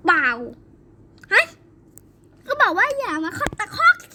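a hint of a bossy nanny telling her off; scolding nanny.

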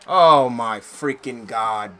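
slow, loud drawn-out groan of disbelief; loud exasperation. Real, not acted. Gamer reacting to another blown play, loud and slow. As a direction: stretch every word out in frustration.

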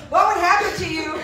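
real laughter breaking into the words; laughing while talking. Real, not acted. Breaks up laughing while addressing audience.

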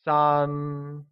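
San held high and level in Mandarin. Shown as a demonstration. Teacher modelling San with a high level tone for learners. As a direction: keep San flat and high throughout. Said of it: "pronounced with a high level tone".

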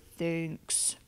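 low, soft gentle bear; a big shy bear mumbling thanks. Acted. Bear thanking Mouse for the pie, low and soft. Bear's voice.

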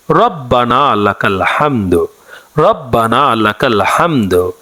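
slow, careful recitation of রব্বানা লাকাল হামদু; model recitation. Shown as a demonstration. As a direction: recite slowly for learners to repeat. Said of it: "তাহমিদটি হচ্ছে".

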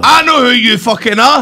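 loud, high cry of recognition; excited shout. Real, not acted. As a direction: yell it as the answer hits you. Contestant suddenly guesses mystery guest.

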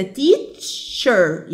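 teacher slowly split in two; syllable by syllable. Shown as a demonstration. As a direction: draw out teacher as two beats. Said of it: "احنا قسمناها ازاي". (مقطّعة ببطء إلى مقطعين)

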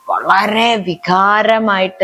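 ordinary talk, nothing marked

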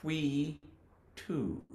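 softly shaped whee, two, lips then rounded; slow soft whee and two; shaped syllables. Shown as a demonstration. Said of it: "shape their mouth like this".

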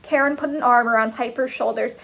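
plain reading voice

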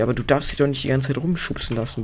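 ordinary delivery

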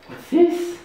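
mock surprised gasp. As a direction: gasp then very high question. Mock gasp to excite dog.

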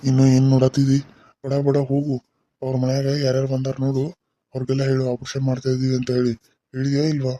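man's voice. Pretend. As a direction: drop the voice low to pass as the male doctor. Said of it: "ಅವ್ನ ಗತಿ ನಾನು ಮಾತಾಡ್ಬೇಕು".

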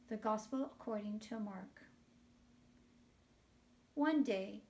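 normal talking voice

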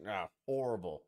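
usual speaking voice

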